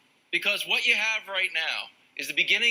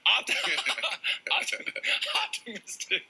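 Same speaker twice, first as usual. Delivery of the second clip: laughing through the word; 3 semitones higher than usual. Real, not acted. Cracks up mid-word.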